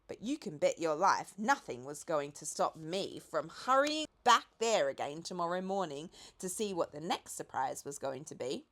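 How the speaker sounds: ordinary delivery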